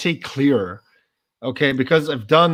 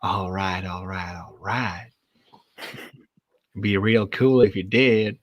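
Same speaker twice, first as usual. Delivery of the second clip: Matthew McConaughey; 6 semitones lower, slower than usual. Acted. Asking co-host to judge a Texas accent, low Texas drawl. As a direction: drawl it low and laid-back in Texan. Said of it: "is he speaking about Matthew McConaughey?".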